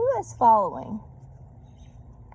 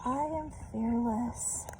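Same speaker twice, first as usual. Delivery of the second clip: slow, softer unsure voice; trying to convince herself; 3 semitones higher, 5 dB quieter, slower than usual. A narrator's reading. Lost Sheila Ray reassuring herself, slow and unsure. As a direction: whisper courage to yourself. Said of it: "tried to convince herself".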